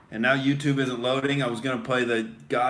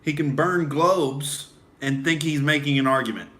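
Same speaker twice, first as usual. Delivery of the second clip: ordinary talk, nothing marked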